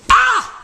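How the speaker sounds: sudden high, loud cry; imitated pained yell. Storyteller imitates man popping shoulder in, sharp loud yell. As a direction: yelp as if popping a joint back. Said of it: "and he goes".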